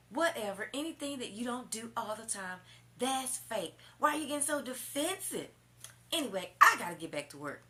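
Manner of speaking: high, loud dismissive mock; mocking the boyfriend. Woman mimics boyfriend calling her fake, loud high mock.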